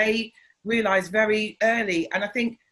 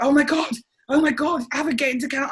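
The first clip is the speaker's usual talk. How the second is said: excited urging; 6 semitones higher, 4 dB louder than usual. Retold or imitated. Friend urging her into counselling.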